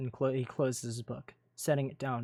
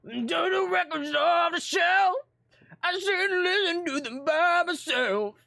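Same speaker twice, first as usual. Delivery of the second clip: hammed-up [name], far higher and louder, slowed; [name] impression; 8 dB louder, slower than usual. [name] recalls talking like [name], hammed-up high shouted [name]. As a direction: belt it out as a mock [name]. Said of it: "I used to talk like [name]".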